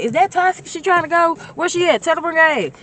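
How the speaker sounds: put-on high and loud girlfriend voice; a homegirl yelling to bring her friend; eager shout. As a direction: holler across the room.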